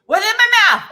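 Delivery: high, loud panicked questions; panicked friend. Retold or imitated. Friend eating the candy bar, panicking high and loud. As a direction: freak out about what's in your mouth. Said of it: "She goes".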